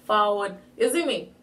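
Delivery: casual Patois greeting to friends; Jamaican Patois. Shown as a demonstration. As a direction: switch into easy, loud-starting Jamaican Patois. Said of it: "someone else that speak Patois".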